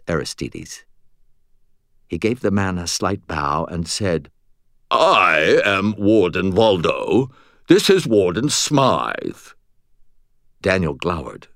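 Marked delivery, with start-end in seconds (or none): 4.9-9.5 slow, drawn-out British introduction; obnoxious British accent. A narrator's reading. Butters introducing the fake wardens, pompous British accent. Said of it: "absolutely obnoxious British accent".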